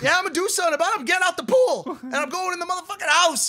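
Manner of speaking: high, hammed-up declaration; mock bravado. Boyfriend refusing to touch the rat, high mock bravado. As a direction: declare it grandly, half laughing. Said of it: "I go".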